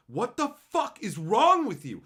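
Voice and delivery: FUCK and WRONG hit loud and high; exasperated outburst. Real, not acted. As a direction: burst out in disbelief, pitch jumping. Repeats the question, exploding.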